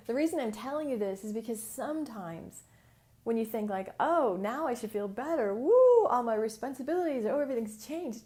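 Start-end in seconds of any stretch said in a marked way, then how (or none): 4.0-8.2 mock relief.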